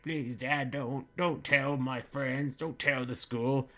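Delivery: put-on crying boy begging his father; crying son begging.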